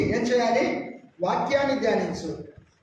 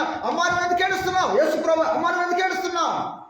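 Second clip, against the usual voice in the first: high, quick acted question to a weeping woman; urgent questioning; 8 semitones higher, faster than usual. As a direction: call out to her, high and insistent. (ఆత్రంగా, గట్టిగా ప్రశ్నించడం)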